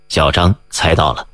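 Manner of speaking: plain reading voice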